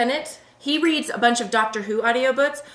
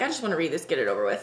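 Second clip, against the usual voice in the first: low, softer droning imitation; bored monotone; 7 semitones lower, 6 dB quieter, much faster than usual. Parent reading aloud without trying accents, mock bored monotone. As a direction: drone it quietly, sinking lower as you go. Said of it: "I'm monotone".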